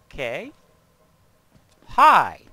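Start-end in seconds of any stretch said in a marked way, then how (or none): 1.9-2.4 sudden loud startled hi. Real, not acted. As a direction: blurt it out in shock. Startled greeting to enemy.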